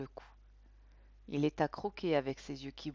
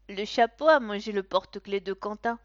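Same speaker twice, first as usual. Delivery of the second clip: exclaiming; 3 semitones higher, 10 dB louder than usual. A narrator's reading. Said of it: "s'exclame Capucine".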